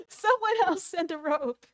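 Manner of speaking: high, loud comic plea; acted desperate call; yelling for rescue. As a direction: holler up for a rope. Jack still yelling for rescue.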